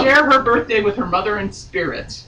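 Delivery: steady read-aloud novel voice; story narration. A narrator's reading. Reading novel aloud on podcast.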